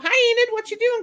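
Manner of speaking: high, loud cheery hello; excited greeting. Real, not acted. Host greeting Enid as she joins, excited and high. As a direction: call out to a friend arriving.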